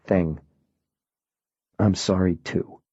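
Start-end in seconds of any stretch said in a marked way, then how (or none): 1.8-2.8 much softer, fading to almost nothing; soft comfort. Acted. Miles holding the colonel's head, softer and fading. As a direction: let it die away in grief.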